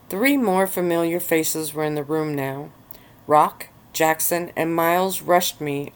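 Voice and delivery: ordinary delivery, nothing marked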